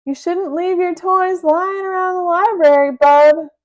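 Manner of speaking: high, loud, sly taunt; a boy slyly taunting the villain. Acted. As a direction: tease him with a trick up your sleeve. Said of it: "he said slyly".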